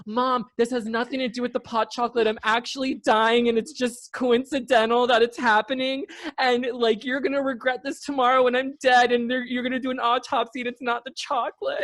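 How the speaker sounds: a hammed-up high panic to mom; panicked crying plea. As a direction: wail to mom as if truly dying. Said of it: "like, crying".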